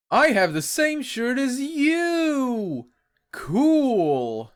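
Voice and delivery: high, slow, gushing fan voice; high cartoon voice. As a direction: squeal it like an excited pony. Reading comic dialogue aloud.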